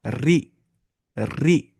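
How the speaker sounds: "री" with a fine, light R; Tajweed light ra on "री"; light thin R. Shown as a demonstration. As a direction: say "री" thinly, tongue forward, clear ee. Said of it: "हल्की आवाज़ निकालिए".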